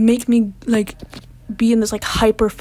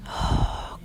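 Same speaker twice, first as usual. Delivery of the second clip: heavy sigh; 8 dB quieter than usual. Real, not acted. Starting the panic attack story.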